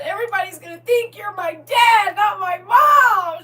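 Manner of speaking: loud, high mock wail of a child; upset child. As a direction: imitate a distraught kid, stretching the last words. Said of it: "She was so upset".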